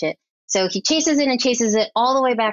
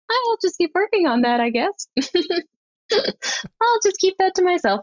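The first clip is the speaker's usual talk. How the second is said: much higher, mock-timid voice, dropping to plain on "I guess"; sheepish self-quote; 12 semitones higher than usual. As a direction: squeak out a shy little promise to yourself. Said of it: "And I'm like".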